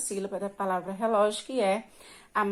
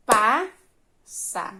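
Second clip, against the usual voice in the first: "passa" split into strong PAS, long gap, sa; clapped syllables; 4 dB louder than usual. Shown as a demonstration. Teacher repeating the clapping example, "passa" broken into syllables. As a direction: say "passa" in two beats, first one stressed. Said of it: "batendo palmas, sempre que pronunciar".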